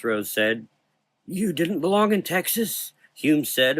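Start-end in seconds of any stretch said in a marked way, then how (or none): 1.3-2.9 put-on high, puzzled old man; ask, bemused. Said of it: "a bit puzzled".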